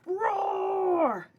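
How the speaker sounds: high shouted dinosaur roar. Acted. Upset boy roaring for his book, high dinosaur roar. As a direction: let loose a shrieking little-dinosaur roar. Daryl roars again.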